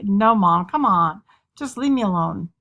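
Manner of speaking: put-on loud, fed-up teenage protest; annoyed teenager pleading to be left alone; exasperated teen. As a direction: push a nosy mom away. Acted teen fed up with questions.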